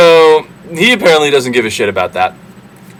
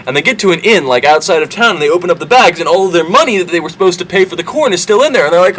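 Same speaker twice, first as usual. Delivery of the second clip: ordinary talk, nothing marked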